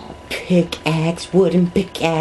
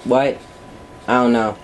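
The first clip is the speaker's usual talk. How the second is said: usual speaking voice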